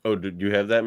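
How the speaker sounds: ordinary delivery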